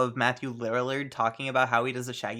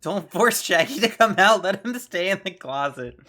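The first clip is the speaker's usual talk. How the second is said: higher and louder joking retort; playful shout; teasing call; 5 semitones higher, 8 dB louder than usual. Real, not acted. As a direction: tease them loudly across the room. Joking reply to Shaggy talk.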